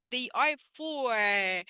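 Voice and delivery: "They are four" drawn out slowly, "four" leaned on; stress on four. Shown as a demonstration. Teacher correcting a student's count, slow and firm. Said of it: "four according to the vowels".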